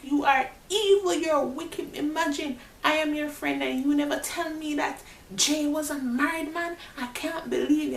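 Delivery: high, indignant scolding; hurt accusation. Retold or imitated. Confronting her friend D for hiding the marriage, high and indignant. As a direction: accuse the friend who kept the secret.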